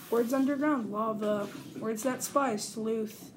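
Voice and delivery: ordinary delivery, nothing marked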